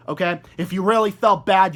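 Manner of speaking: ordinary delivery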